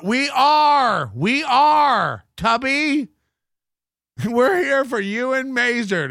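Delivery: loud mocking cheer; mock cheering shout. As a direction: yell it like a sarcastic fan. Mock chant at streamer.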